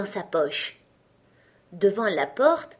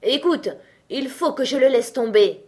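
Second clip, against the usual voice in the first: crying out; 5 semitones higher, 5 dB louder than usual. A narrator's reading. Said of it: "il s'écria".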